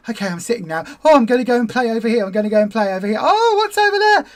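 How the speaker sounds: playful puppy voicing its distraction. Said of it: "And then the puppy goes".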